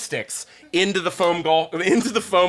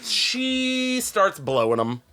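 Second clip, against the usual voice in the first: slow, higher, stretched delivery; drawn-out reveal; 8 semitones higher, much slower than usual. Real, not acted. Storyteller dropping the shock twist, drawn out. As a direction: drag out SHE for the big reveal.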